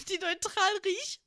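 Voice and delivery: giggling through the words; laughing. Real, not acted. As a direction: laugh as you say it. Giggling at own joke.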